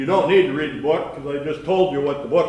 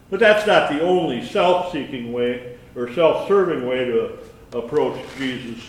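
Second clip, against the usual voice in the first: normal talking voice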